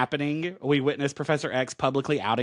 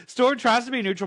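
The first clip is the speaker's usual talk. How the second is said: normal talking voice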